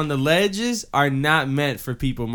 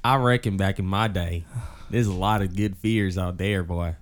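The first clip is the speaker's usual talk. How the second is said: put-on Southern accent; 6 semitones lower, 6 dB quieter than usual. Friend jokes to the group about old-time fears, Southern accent. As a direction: drop low into a folksy Southern accent. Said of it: "you sound a little, a little Forrest Gumpy".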